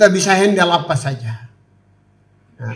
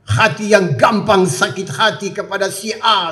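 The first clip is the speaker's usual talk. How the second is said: usual speaking voice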